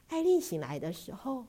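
ordinary delivery

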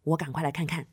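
usual speaking voice

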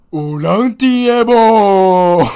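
long drawn-out yell, high and loud; shouting into a cave. Retold or imitated. Man yelling into a cave to ask if anyone's there, drawn-out shout. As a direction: call out slowly into the dark cave. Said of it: "对着山洞啊大喊".

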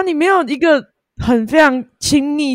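usual speaking voice

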